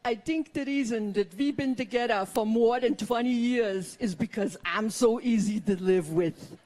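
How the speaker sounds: put-on husband's thick Austrian accent, Schwarzenegger style; mock Schwarzenegger-style Austrian accent. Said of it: "He talks like Arnold Schwarzenegger".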